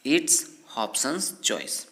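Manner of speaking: very slow, word by word, spelled out for learners; slow teaching read. Shown as a demonstration. English teacher dictates the sentence slowly to learners. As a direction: stretch each word like dictation. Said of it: "क्या बोलेंगे".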